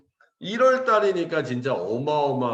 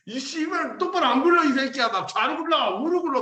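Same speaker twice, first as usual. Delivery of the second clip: high-pitched, furious mock barking; drill-instructor yell; 12 semitones higher than usual. Instructor to rolling recruits, angry mock yell. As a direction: imitate an instructor screaming orders at recruits.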